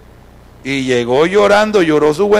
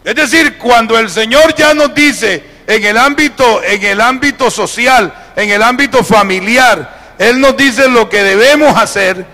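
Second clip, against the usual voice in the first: higher, loud preaching voice; predicación enérgica; 6 semitones higher, 5 dB louder than usual. Acted. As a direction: drive each phrase home to the congregation. Preaches at full force. (prédica a plena voz)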